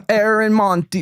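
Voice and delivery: mock Scottish accent; attempted Scottish accent. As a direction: push on in wobbly Scottish. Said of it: "so Scottish accent?".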